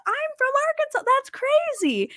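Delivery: very high, squealing retold excitement; excited disbelief. Woman tells two strangers she shares their home state, excited. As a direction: imitate an amazed squeal on finding someone from home. Acted excited reveal to fellow Arkansans.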